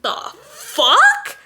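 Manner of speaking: high-pitched, incredulous outburst; retold shocked disbelief. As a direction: let it leap up high in disbelief. Retelling her incredulous reaction.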